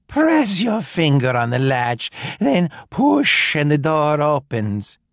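a hint of a sly old granny; wolf posing as granny. A narrator's reading. Wolf in bed inviting the girl in, lightly disguised.

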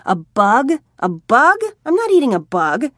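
new vampire protesting being fed a bug; outraged disbelief. A narrator's reading. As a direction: refuse the idea loudly. Tommy outraged at eating bugs.

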